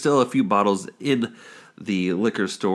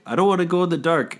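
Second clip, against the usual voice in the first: higher, quick imitation of a frightened child; mock scared kid; 7 semitones higher, faster than usual. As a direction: lightly play a kid faking fear. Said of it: "to mimic being scared".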